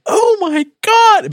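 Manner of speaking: high, slow, loud "Oh my God"; mock amazed gasp. Older relative hearing about a podcast, mock amazed. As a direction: gush like an impressed older relative. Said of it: "And they're like".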